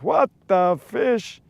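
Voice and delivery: usual speaking voice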